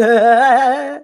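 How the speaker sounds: high cackle of a madman; mocking laugh. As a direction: laugh down at the fools.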